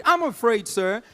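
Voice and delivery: ordinary talk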